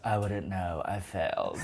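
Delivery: low, soft and deflated; glum deadpan. Retold or imitated. Cher admits failing, soft low deflated. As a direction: mumble it, crushed. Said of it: "she goes".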